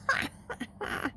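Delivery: breathy high jeering laugh; airy high laugh. Acted. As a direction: laugh him down. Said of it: "laughing again".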